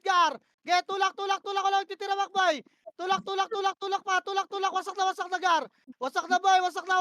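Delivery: loud, high, urgent calls; yelled commands. Real, not acted. Shotcaller screaming push orders mid-fight. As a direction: bark the push at the squad.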